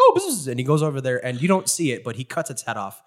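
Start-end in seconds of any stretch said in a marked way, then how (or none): 0.0-0.5 high mock buzzing; lightly voiced bee. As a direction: squeak it like a silly bee. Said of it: "He's like".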